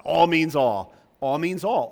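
all punched loud, repeated; stress on all. Retold or imitated. Quoting his old pastor, stressing all. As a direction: hit both alls hard. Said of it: "He said that a lot".

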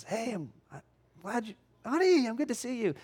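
put-on high-pitched, eager hello to a child; happy greeting. Father coming home to his daughter, very happy. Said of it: "said very happily".

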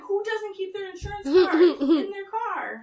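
exasperated disbelief. Retold or imitated. Incredulous retort quoted.

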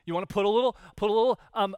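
ordinary delivery

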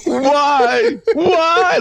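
high, crying shout; mock melodramatic cry. Friend echoing the overacted scene, crying out. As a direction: wail it like a bad actress. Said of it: "everything she does is just extra".

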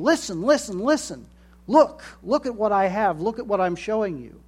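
high, loud, pushy repeating; clamouring voices. Retold or imitated. Preacher mimics people demanding attention, high and pushy. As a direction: nag for attention like an eager salesman. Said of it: "all these people saying".

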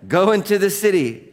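loud, high-pitched order; commanding shout. Acted. Preacher as Jesus ordering Saul onward, commanding shout. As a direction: send him off with force.

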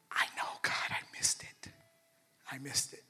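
breathy regretful whisper to God; whispered confession. Retold or imitated. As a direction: admit it under your breath.

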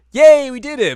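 high, loud "yay"; mock victory cheer. Team thinks the enemy is beaten, high loud mock cheer. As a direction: cheer in a high, shouted burst. Acted cheer after cutting off head.

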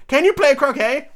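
high, loud demand; shouting Queen. A narrator's reading. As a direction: yell the question imperiously. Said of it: "shouted the Queen".

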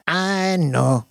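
high, drawn sigh of agreement. A narrator's reading. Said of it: "she sighed".

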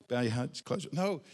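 normal talking voice

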